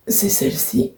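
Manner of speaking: soft whispered remark. Real, not acted. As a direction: whisper it close to the mic. Quiet aside while filming. (chuchoté)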